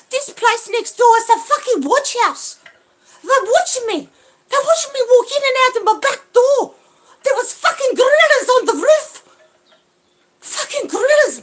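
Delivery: high, loud, close to tears; panicked outburst; distressed yell; frantic shouting. Real, not acted. Paranoid rant about neighbours.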